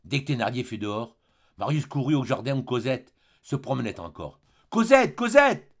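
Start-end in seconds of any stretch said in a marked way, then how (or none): 4.7-5.7 high, slow, loud call across the garden; crying out. A narrator's reading. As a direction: call her name out loud and long. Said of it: "cria-t-il".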